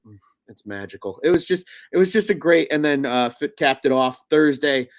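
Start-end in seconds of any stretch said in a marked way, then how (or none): 0.1-1.2 dreamy hush. Retold or imitated. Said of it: "I was just like".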